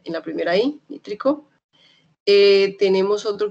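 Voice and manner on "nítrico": nítrico with NÍ raised and stressed. Shown as a demonstration. Teacher marking where the accent falls in nítrico for students. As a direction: lift and hit the NÍ in nítrico. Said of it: "la E tilde es en la primera I".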